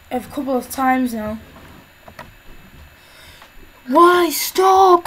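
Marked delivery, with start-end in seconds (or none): 3.9-5.1 loud, raised-pitch protest; real frustrated wail; exasperated cry. Real, not acted. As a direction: cry out at the game to stop. Exasperated at the game.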